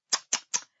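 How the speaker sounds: сокрушённое цоканье языком; тихо поцокать языком с досадой; щёлканье языком. A narrator's reading. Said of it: "сокрушённо щёлкал языком король". (король сокрушённо цокает языком)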